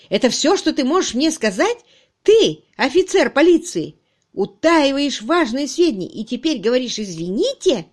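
loud, high-pitched indignant tirade; outraged boss, hammering each point; furious reprimand. Acted. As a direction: berate your subordinate in disbelief. Chief berates police officer.

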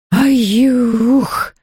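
short high howled shriek; ghost shriek. Acted. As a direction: let out a startled ghostly yelp. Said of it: "завопило привидение".

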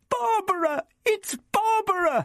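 put-on very high girlish squeal; excited shriek. A narrator's reading. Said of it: "Vicky shrieked excitedly".

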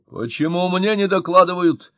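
high, loud indignant demand; angry boss. A narrator's reading. Mayor angered at not being told, high loud demand. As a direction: bristle with official outrage. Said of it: "совсем уж рассердился Мощин".

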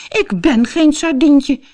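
high, slow, indignant protest; huffy, high-pitched objection; offended. A narrator's reading. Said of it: "zei Devo beledigd".

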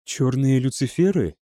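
usual speaking voice

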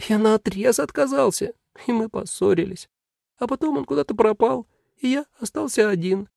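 a touch of sorrow in a high voice; sad demon. Acted. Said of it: "демон печально сказал".

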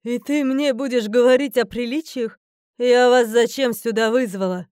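loud goddess challenge; challenging. A narrator's reading. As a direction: demand an answer indignantly. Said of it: "с вызовом спросила богиня". (громко, с вызовом и возмущением)

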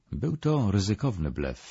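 usual speaking voice

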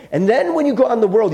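high, loud and quick mock advice; mock breezy instruction. Mock Jesus sending disciples off, breezily. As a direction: rattle it off as a hammed-up send-off.

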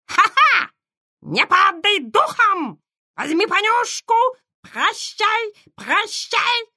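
very high, shrill parrot squawk; shrill parrot screech. A narrator's reading. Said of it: "пронзительно завизжал попугай".

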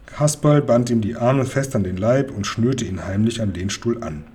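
plain reading voice

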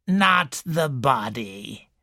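put-on slow, wheezy old woman's voice; slow wheezing invalid complaining to a visitor; wheezing old lady. A narrator's reading. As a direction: wheeze it out like a sickly matriarch. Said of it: "wheezed Missus Boynton".

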